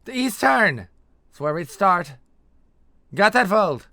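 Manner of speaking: high, full shout over the shoulder. A narrator's reading. Interrogator calling to her partner behind her, shouted. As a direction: call it out loudly to someone behind you. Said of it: "shouted to the man behind her".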